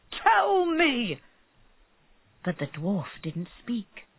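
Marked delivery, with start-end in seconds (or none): 0.1-1.2 shouted demand. A narrator's reading. Bird seller demanding answer.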